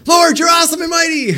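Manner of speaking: very high, loud shouted praise; acting weird. Jeremiah suddenly praising the Lord, erratic high shout. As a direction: swing into over-the-top shrieked praise. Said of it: "See how he's acting weird?".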